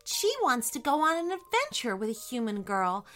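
high-voiced mother hen asking a favour; gently appealing high character voice; polite hen. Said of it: "in a very polite way".

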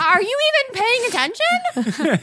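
high, slow incredulous scolding; comic indignant outrage; mock exasperation. Players mock-scold the game master.

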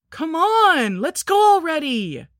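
put-on high, loud whine of an impatient kid; half-scream it like an impatient six-year-old; loud, high-pitched, drawn-out pleading. A narrator's reading. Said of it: "Greg half screamed".

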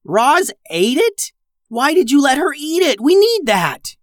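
high, loud disbelieving shout. Acted. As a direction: shout in shock at sister. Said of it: "shouted Aubrey".

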